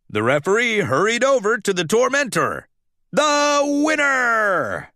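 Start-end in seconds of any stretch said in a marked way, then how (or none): 3.1-4.9 slow, drawn-out winner call in another voice; announcer declaring winner. Acted. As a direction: boom it out like a ring announcer.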